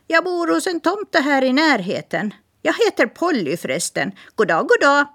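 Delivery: high, quick parrot voice; chatty parrot. Acted. Parrot introducing herself to Smirke, high bird voice. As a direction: rattle it off like a talkative bird.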